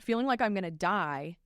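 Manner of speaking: usual speaking voice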